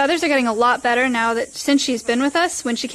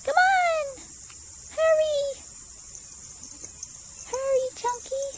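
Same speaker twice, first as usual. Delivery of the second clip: high excited calling; 4 dB quieter than usual. Real, not acted. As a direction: call them over, high and eager. Calling goats to follow.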